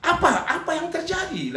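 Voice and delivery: high-pitched, acted startled question; alarmed question. Pastor asking the weeping wife what happened, acted alarm. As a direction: imitate a pastor taken aback.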